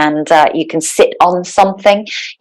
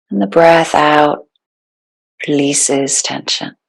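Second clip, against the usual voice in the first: calm, unhurried meditation guide with long pauses; guided meditation. Real, not acted. Yoga teacher settling the class into breathwork, guided meditation. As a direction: ease them into stillness, phrase by phrase.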